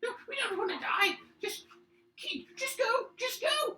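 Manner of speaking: very high, loud squeaky creature; pleading kobold. Acted. Kobolds beg the adventurers to go, shrill panicked squeak.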